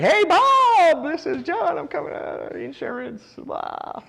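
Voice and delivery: high, loud, bright-pitched fake cold-call greeting; hammed-up chirpy caller voice; mocking salesy phone voice. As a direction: imitate an over-eager agent on the phone. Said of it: "You're like".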